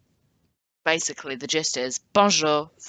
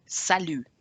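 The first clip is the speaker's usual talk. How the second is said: ordinary talk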